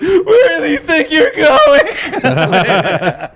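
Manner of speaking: mock wail, pitched very high and drawn out; imitated shriek. Comic retelling of crying girlfriend chasing him through the crowd, high drawn-out shriek. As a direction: shriek it like a distraught pursuer.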